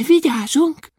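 very high, small dwarf voice; thin voice. A narrator's reading. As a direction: pipe the promise thin and high. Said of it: "mondta Kökölyszi vékonyan". (vékony, magas törpehang)

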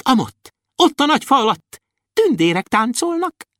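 eager high elf voice pointing out marvels; high dwarf voice. A narrator's reading. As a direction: play a tiny excited dwarf showing off the world. Dwarf Kökőszi speaks to Andris.

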